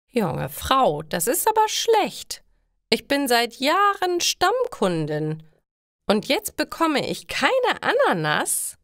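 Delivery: put-on an indignant old regular scolding; annoyed old lady. A narrator's reading. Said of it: "Frau Weber klingt sehr verärgert".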